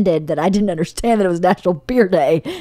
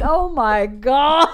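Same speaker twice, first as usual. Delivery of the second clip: slow, higher-pitched mock exasperation; retold drawn-out groan of a phrase; exasperated; 5 semitones higher, much slower than usual. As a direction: drag out each word in disbelief. Acting her exasperated reaction.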